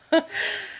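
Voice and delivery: a quick giggle breaking through; can't help a little laugh; amused giggle at her husband's nerve; short high laugh. Real, not acted.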